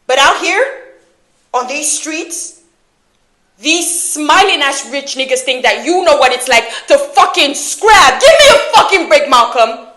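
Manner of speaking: furious, incredulous tirade peaking in a yell; exasperated yell. Acted. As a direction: shout them down in disgust. Acted quarrel, exasperated outburst.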